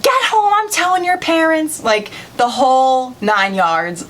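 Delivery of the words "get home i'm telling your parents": high-pitched mock scolding mother; imitated yelling. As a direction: order kids off in a pitched-up scold. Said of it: "yells at the other two girls".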